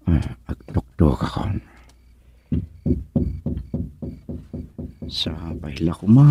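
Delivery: soft, tense murmur; nervous hush at the door. Acted. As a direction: keep it down before knocking. About to knock, hushed.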